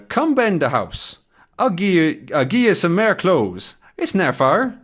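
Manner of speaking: high-pitched imitated Scottish brogue; put-on Scottish accent. Shepherd inviting Tintin home, put-on Scottish accent. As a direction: lilt it in mock Scottish. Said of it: "he has a bit of an accent".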